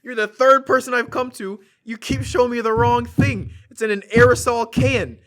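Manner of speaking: hammed-up indignant customer; imitated angry complaint. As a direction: scold a useless clerk as the old man. Acting out irate old customer's complaint.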